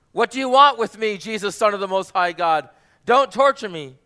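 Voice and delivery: loud, high anguished outcry; demon's desperate cry. Acted. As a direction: scream it as a tormented possessed man. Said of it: "he's crying out to him".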